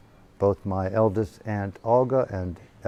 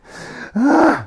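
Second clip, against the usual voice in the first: high, loud theatrical sigh; dramatic crying sigh; 6 dB louder than usual. Retold or imitated. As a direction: let out a showy wailing sigh. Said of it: "Like this".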